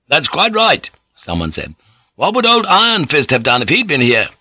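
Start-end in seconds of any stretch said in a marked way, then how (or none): 0.1-0.9 higher, louder eager agreement; excited general. Acted. Narrator voicing a general. 2.2-4.3 raised, louder indignant question; indignant general. Acted. Narrator voicing a general.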